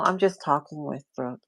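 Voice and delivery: usual speaking voice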